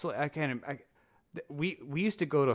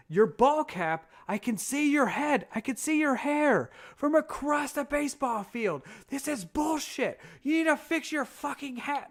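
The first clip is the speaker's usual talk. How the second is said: high, loud, outraged mock tirade; imitated yelling chief; 6 dB louder than usual. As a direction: chew out a sailor from across a field. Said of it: "this chief yelling at me".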